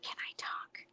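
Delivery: imitating a child's tiny whisper; barely audible mimicked whisper; retold whisper. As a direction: whisper it with no voice, very softly. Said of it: "she just whispered".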